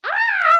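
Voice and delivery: high pretend shriek; piercing storybook scream; princess scream. A narrator's reading. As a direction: scream like an outraged little princess. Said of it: "screamed Princess Precious".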